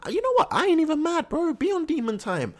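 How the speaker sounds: high-pitched hype reaction; excited squeal. Real, not acted. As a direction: squeal with fan delight. Hyped reaction to Shinji.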